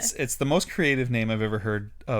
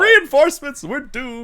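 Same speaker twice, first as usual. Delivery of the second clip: loud, high mock panic; hammed-up wail of doom; freaking out; 13 semitones higher, 9 dB louder than usual. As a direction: shout it like all is lost. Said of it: "He's freaking out".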